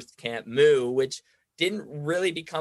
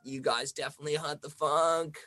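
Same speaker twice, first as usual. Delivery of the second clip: softer, slow, dreamy stoner drawl; imitating a stoned girl; 4 dB quieter, slower than usual. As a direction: play a tripping fan in awe of the DJs. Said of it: "high on mushrooms or something".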